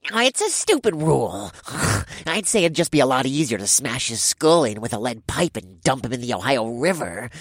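nasal complaining. Acted.

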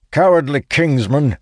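furious bellow, high and loud. A narrator's reading. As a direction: roar the insult at him. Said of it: "Sturgis bellows".

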